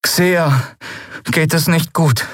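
put-on high, troubled young voice; deeply downcast. A narrator's reading. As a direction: break bad news, high and dejected. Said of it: "Miko klang tief bedrückt". (tief bedrückt, mit hoher Stimme)

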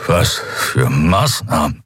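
slow, strained question; weakened prisoner. Acted. Prisoner barely upright asking about the measures, weak and strained. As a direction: barely get the words out.

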